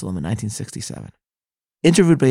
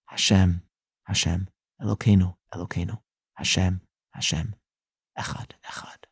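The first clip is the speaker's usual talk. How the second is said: softer and softer, lower, ending in a whisper; weeping prayer fading to whisper; 4 semitones lower, 6 dB quieter than usual. A narrator's reading. Sobbing soldier at the Kotel repeats the Shema, fading to whisper. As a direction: let the prayer dissolve into a broken whisper. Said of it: "and he cried".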